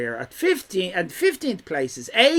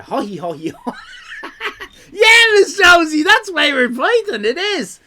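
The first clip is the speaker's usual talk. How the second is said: high, loud, hammed-up mock Welsh accent with laugh-shout; 10 semitones higher, 7 dB louder than usual. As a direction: slip back into mocking his Welsh voice, laughing. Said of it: "he talks like this".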